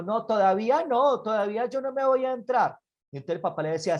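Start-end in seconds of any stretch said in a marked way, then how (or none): 0.0-2.8 put-on higher-pitched kid's refusal; defiant child. Boy refuses father's call indoors, high and defiant. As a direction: play a little boy digging his heels in.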